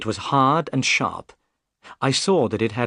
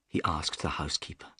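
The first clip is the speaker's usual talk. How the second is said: plain reading voice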